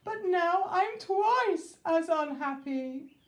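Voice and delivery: high, loud wailing king. Acted. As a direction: wail it miserably. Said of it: "he wailed".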